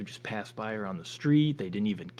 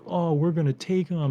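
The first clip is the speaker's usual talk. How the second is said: mock bravado; 7 semitones higher, 6 dB louder than usual. Aliens boasting before attacking.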